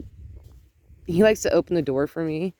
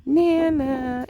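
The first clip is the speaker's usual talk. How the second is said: put-on toddler calling; 8 semitones higher, much slower than usual. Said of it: "ran around all day long saying".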